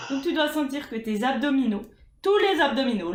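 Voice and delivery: normal talking voice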